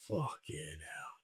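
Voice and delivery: very soft, slow and low, under the breath; muttered retold curse. Older comic eyeing the kit, slow hushed muttering. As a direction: imitate a weary private grumble. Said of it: "And he's like".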